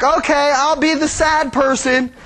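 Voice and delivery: put-on perky little kid taking a role; eager child volunteering to be the sad one; kids playing. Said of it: "they would be like".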